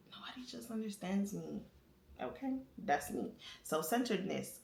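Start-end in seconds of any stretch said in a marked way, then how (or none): 0.1-1.6 hushed, confiding whisper; whispered aside. Real, not acted. Asking viewers who feels misunderstood, whispering. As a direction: lean in and whisper to the audience.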